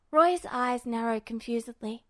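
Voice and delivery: plain reading voice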